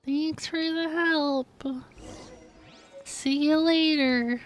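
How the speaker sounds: ordinary delivery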